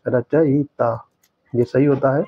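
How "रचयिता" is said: रचयिता drawn out very slowly; slow spelled-out word. Shown as a demonstration. Teacher showing students the right spelling, very slow. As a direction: say रचयिता syllable by syllable. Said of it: "रचयिता होता है".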